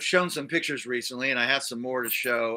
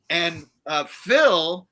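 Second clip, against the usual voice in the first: ordinary delivery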